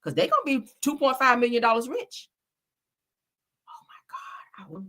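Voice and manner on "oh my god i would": breathless whispered gasp; awed whisper at the lost jackpot; stunned whisper. Real, not acted. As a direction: whisper it, too shocked to voice it.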